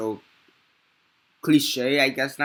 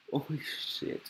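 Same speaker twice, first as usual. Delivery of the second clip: barely voiced, very soft; stunned hush; 4 semitones higher, 10 dB quieter than usual. Real, not acted. Reviewer reacting to a baffling movie ending, stunned hush. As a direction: let it out under your breath, stunned.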